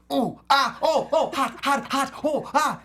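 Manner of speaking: high yelps of a man on fire; burning yelps. Retold or imitated. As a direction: yelp like a game character burning. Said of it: "they do this".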